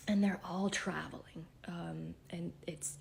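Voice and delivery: barely able to voice the words; losing voice. Real, not acted. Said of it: "I can't even talk".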